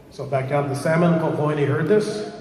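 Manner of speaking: ordinary delivery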